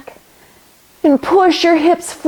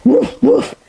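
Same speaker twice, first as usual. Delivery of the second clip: dog barks. Pretend. Teacher barking with kids in dog pose, playful woofs. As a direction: bark along like a happy puppy. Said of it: "now we're dog".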